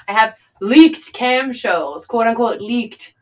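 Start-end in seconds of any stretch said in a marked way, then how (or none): none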